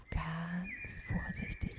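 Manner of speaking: hushed, slow storytelling; suspenseful near-whisper; very cautious. A narrator's reading. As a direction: stretch it out softly, as if creeping. Narrator mimics the careful creeping.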